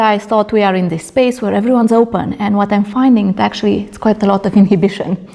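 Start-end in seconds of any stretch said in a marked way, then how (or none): none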